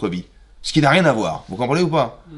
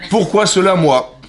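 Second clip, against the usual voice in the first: laughing broken French, pitched up; 6 semitones higher, 4 dB louder, slower than usual. Shown as a demonstration. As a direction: say it like clumsy word-for-word French. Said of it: "c'est du petit nègre".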